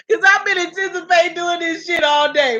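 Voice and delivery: high voice right after a laughing fit; giggly. Real, not acted. Host explaining the giggles to viewers, high and giggly. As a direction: squeal it out, still tickled.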